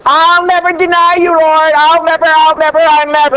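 loud, high, insistent vow of loyalty; defiant boast. Acted. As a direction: Peter declaring he'll never deny, loudly. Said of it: "who had an attitude".

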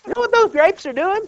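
high, loud, joyful preaching; exultant sermon climax; preaching at full force. Acted. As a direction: lift it high and celebrate. Preacher peak on abiding.

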